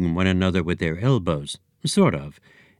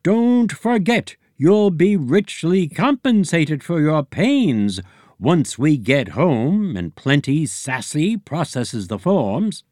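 high, loud blustering egg boss; Eggy Senior voice; 7 semitones higher, 3 dB louder, slower than usual. A narrator's reading. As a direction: bluster like a pompous egg chief.

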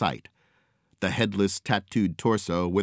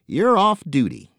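pirate voice; 10 semitones higher, 6 dB louder than usual. A narrator's reading. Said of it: "chuckled Angel".

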